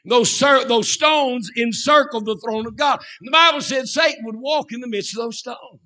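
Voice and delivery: high, loud pulpit proclamation; full-force preaching. Real, not acted. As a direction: preach it out, punching the key words high and loud.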